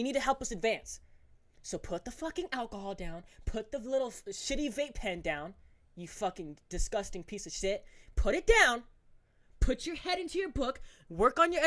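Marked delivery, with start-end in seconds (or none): none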